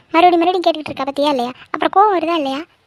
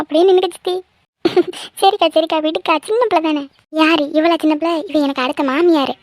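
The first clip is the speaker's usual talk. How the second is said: ordinary talk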